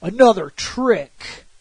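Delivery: weary exasperation. Retold or imitated. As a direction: sigh it out, drained and done. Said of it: "And then he's like".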